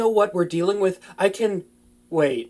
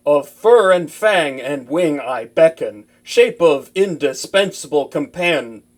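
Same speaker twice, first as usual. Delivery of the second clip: slow, loud ritual chant; casting a summoning spell, slow and loud; incantation; 4 semitones lower, 5 dB louder than usual. Acted. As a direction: intone the spell solemnly and slowly. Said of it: "say the magic words".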